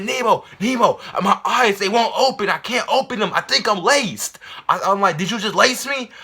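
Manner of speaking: high, loud panicked pleading; high loud frantic cry; freaked out. Retold or imitated. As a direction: beg your friend in a panic. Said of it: "I was freaking out".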